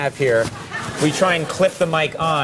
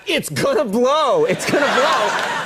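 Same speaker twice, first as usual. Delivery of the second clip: higher, slow mock shout; shouting a warning; 7 semitones higher, slower than usual. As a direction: yell it out to the crowd. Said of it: "I was shouting to the crowd".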